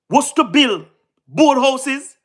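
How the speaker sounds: normal talking voice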